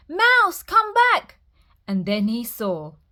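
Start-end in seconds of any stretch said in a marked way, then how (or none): 0.1-1.4 very high, loud call across a storm; shouting. A narrator's reading. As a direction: bear shouts for his lost friend.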